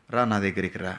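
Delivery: God gently calling you near; tender invitation. Retold or imitated. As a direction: softly beckon like a loving father. God invites, contrast to shooing.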